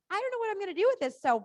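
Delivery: high, fast excited horse voice; playful horse voice, fast and loud; horse's thoughts. Retold or imitated. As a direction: voice the horse bursting with energy, boing. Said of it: "and he goes".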